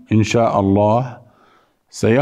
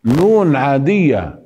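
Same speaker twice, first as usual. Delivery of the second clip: ordinary talk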